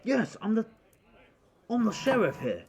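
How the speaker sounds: high, loud proud announcement; loud sheriff. Acted. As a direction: boom out your title. Sheriff announces himself loudly.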